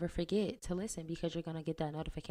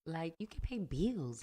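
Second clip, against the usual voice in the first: a hint of [name]'s voice; lightly put-on Cardi-style delivery. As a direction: keep up the playful Cardi imitation. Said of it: "got your whole Cardi voice".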